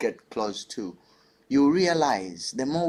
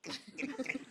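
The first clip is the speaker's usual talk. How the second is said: dance clicks; 12 semitones higher, 11 dB quieter than usual. Shown as a demonstration. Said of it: "I'm like".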